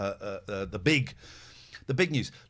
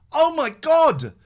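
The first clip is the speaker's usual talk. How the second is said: loud, slow oh my God of realisation; suddenly remembering, slow and loud; surprised exclaim; 7 semitones higher, 9 dB louder, slower than usual. Real, not acted.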